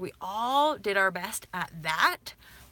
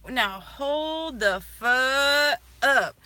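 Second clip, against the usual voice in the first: slow, drawn-out hold it; very slow, firm stop; slow warning; 5 semitones higher, 5 dB louder, much slower than usual. Retold or imitated. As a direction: stretch out every word as a warning. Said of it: "this sudden awakening shift of being like".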